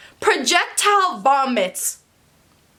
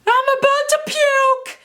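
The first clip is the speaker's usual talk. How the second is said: put-on high, slow, drawn-out drunk wail; drunk girl yelling; 3 dB louder, slower than usual. Drunk girl at the party, high drawn-out wail. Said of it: "All of a sudden I hear".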